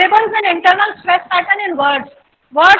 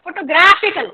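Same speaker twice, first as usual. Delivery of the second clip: फोटोग्राफिकल as fo-to-GRAF-ical; stress on graph. Shown as a demonstration. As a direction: punch graph in फोटोग्राफिकल. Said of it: "graph है". (फोटोग्राफिकल में graph पर ज़ोर)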